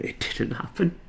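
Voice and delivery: breathy whispered relief. Shown as a demonstration. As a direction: whisper it with a rising lilt.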